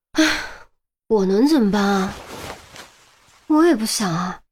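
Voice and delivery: sighing, helpless complaint; defeated and plaintive; helpless lament. A narrator's reading. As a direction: moan that it was out of your hands. Cornered, squatting down miserably.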